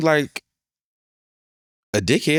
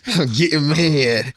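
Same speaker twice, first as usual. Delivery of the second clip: slow, hammed-up mock anger; mock angry; 3 semitones higher, slower than usual. Repeats quote slow, mock.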